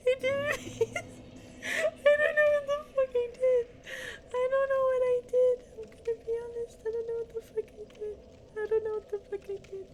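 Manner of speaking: high voice breaking with laughter; laughing while talking. Real, not acted. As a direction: squeak the words through giggles. Laughing through disbelief after mistake.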